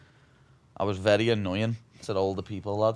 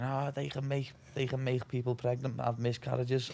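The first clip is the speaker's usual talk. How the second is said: mock panicked teacher; 4 semitones higher, 4 dB quieter than usual. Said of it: "teachers going bananas".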